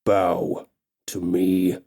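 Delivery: very slow, low orc command; orc king order. Acted. Orc king ordering the elf to bow, slow and low. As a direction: drag it low and heavy. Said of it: "Gorok instructs her".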